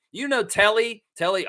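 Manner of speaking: normal talking voice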